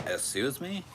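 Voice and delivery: rising mock Valley Girl question. Mocking a Valley Girl with a high excuse me. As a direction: ask it like a snooty Valley Girl. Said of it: "Sound like some Valley Girl bitch".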